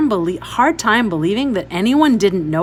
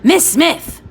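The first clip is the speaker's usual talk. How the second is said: loud, high bark of a name; barked call; 8 semitones higher, 7 dB louder than usual. A narrator's reading. Stern woman calling into the silent house, a loud bark. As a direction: bang on the door and bark it. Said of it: "she barked".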